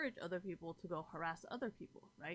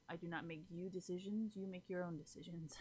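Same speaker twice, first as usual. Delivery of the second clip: whispered aside; 6 dB quieter than usual. Real, not acted. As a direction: drop to a whisper for the last line. Sly whispered aside to viewers.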